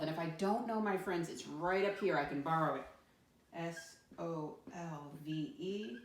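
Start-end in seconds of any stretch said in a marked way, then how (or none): 3.5-6.0 slow spelling. Shown as a demonstration. Teacher spells word for class.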